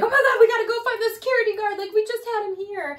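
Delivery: high, loud and fast alarm; alarmed rush. Retold or imitated. Gal hearing about the bat, high fast alarmed outburst. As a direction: blurt it out in a panicked rush.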